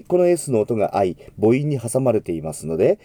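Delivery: normal talking voice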